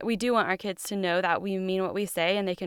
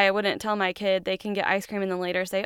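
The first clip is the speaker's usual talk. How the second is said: ordinary talk, nothing marked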